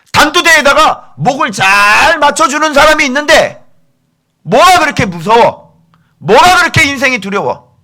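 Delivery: loud, high-pitched accusing sermon; full-force preaching. Real, not acted. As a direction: thunder the rebuke at the congregation. (강하게 질책하는 고음의 설교)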